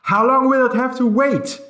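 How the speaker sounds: very high, loud panicked scream; shrill scream of panic; rising to a scream. A narrator's reading. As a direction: scream the question in terror. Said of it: "his voice rose to a scream".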